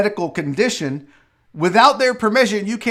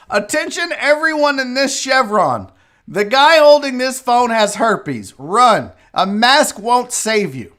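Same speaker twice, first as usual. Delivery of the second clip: higher-pitched, drawn-out mock warning; mock public announcement; 3 semitones higher, 3 dB louder than usual.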